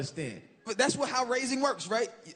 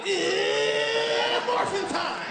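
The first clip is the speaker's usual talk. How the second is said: higher, excited hero shout; mock Power Ranger yell; 13 semitones higher, 4 dB louder, much slower than usual. Comic spoofs the boyfriend climaxing, a loud mock hero cry.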